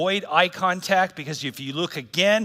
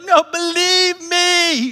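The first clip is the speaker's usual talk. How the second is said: slow, high, loud "Believe, believe me"; pleading Jesus; 12 semitones higher, 8 dB louder, slower than usual. Retold or imitated. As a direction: beg them to trust you, drawn out. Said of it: "He's like".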